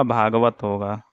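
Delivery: normal talking voice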